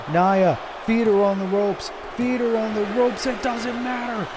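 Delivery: frantic, high play-by-play yelling; excited shout. Real, not acted. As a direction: scream the title change to the crowd. Title changes hands at the climax.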